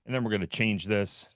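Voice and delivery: normal talking voice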